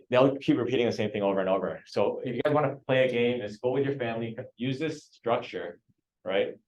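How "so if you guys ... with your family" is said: normal talking voice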